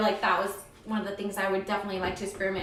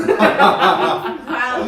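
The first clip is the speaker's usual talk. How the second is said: slow, drawn-out wow through laughter; laughing wow; 11 dB louder than usual. Real, not acted. Friend reacting to the puke story, laughing slowly. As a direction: stretch it out amused.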